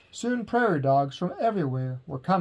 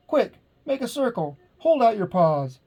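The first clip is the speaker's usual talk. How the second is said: urgent orders; 7 semitones higher, 3 dB louder than usual. A narrator's reading. Pip commanding crowd.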